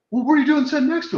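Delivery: put-on high fast kid voice; amazed son. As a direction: rattle it off like an astonished child. Son's amazed question voiced.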